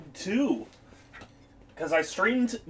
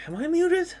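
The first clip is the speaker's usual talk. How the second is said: alarmed; 11 semitones higher than usual. Real, not acted. Sudden fear of being muted.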